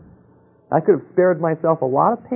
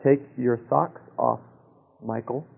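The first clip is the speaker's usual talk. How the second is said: soft and lower, slowed, a hushed inner voice; the quiet voice warning Michael again, hushed and slowed; still small voice; 3 semitones lower, 6 dB quieter than usual. Retold or imitated. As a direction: murmur it gently from within. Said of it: "and the voice said".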